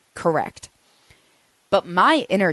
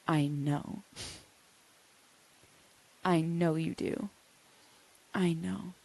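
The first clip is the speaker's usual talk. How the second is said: much softer, gentle soothing; soft reassurance; 8 dB quieter than usual. Shown as a demonstration. Answering her raging inner child, soft and soothing. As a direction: hold her hurt with quiet calm. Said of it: "turn toward her and say".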